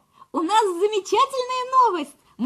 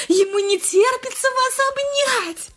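cooing nurse; 6 semitones higher than usual. Acted. Сиделка сюсюкает с больной.

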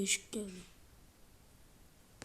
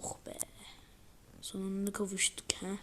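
ordinary talk, nothing marked

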